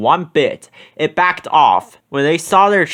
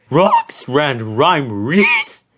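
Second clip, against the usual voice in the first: very slow dog voice; gulping Scooby; much slower than usual. A narrator's reading. Scooby answering Shaggy in trees, very slow nervous dog voice. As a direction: gulp out the words nervously. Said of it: "Scooby gulped".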